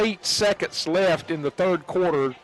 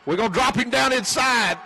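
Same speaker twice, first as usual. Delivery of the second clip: excited; 8 semitones higher than usual. Real, not acted. Live return, tackle call.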